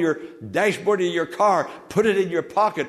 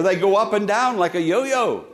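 normal talking voice

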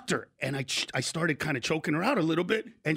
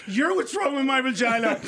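indignant retort; 6 semitones higher than usual. Acted. Woman snaps back at his question about her, indignant. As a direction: throw it back, offended and raised. Imagined furious retort from partner.

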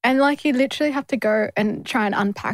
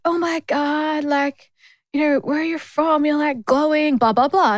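higher-pitched imitation of a gushing stranger; playfully mimicked compliment, pitched up; mock gushing; 6 semitones higher than usual. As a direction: gush as if imitating an overfamiliar admirer.

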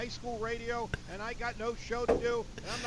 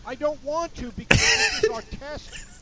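slow, much louder complaint; angry rant; 6 semitones higher, 11 dB louder, slower than usual. Real, not acted. Host refusing to call back in, slow and much louder. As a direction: push back hard, fuming.

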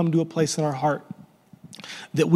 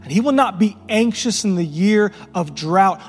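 fervent pulpit preaching, hammering not; preaching at full force; 4 semitones higher than usual. Acted. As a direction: preach it, building with every line.

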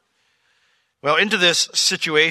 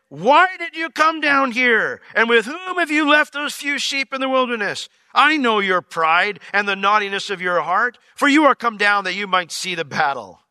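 raised, indignant scolding; livid older brother; 7 semitones higher, 4 dB louder than usual. Acted. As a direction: scold him like a furious big brother. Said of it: "He was livid".

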